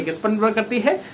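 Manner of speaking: ordinary talk, nothing marked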